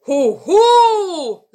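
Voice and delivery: loud high swooping "hoo-hoo"; spooky hooting. Acted. Wizard outside announces himself to grandmother, loud spooky hoot. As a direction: hoot long and loud to frighten. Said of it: "rief es draußen".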